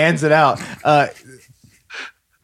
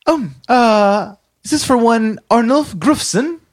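high officious woman voice; 11 semitones higher, 3 dB louder than usual. Acted. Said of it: "inspects it and says".

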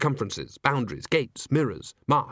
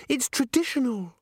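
put-on high, girlish assurance; bubbly cheer; 11 semitones higher than usual. A narrator's reading. Christine explains to doubtful Agnes, bubbling with cheer. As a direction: chirp it as if obvious.

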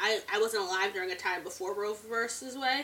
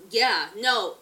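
ordinary talk

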